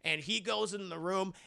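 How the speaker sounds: ordinary talk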